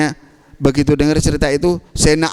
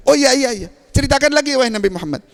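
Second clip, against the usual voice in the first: eager; 10 semitones higher, faster than usual. Acted. Abbas eagerly asks for more.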